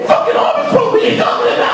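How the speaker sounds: very high squealing outrage; protesting kid. Retold or imitated. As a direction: squeal it like an indignant boy.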